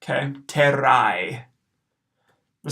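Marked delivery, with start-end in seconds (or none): 0.5-1.4 slow terrai with AE as eye; diphthong demo. Shown as a demonstration. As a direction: model terrai clearly, rolled syllables. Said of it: "will be pronounced terrai".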